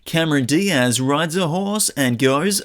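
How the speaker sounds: slow, booming movie-trailer narrator; old sci-fi announcer. Pretend. Trailer voice parody, In a World.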